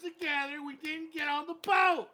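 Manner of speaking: high-pitched exasperated explaining; angry guy. Acted. Angry guy explaining the missed boat, exasperated yelling. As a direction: shout the explanation at them. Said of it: "you're the angry guy who didn't make the".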